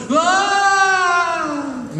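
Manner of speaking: high loud scream of a man falling off a cliff. Shown as a demonstration. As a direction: scream as you fall. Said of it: "now you're falling off a cliff".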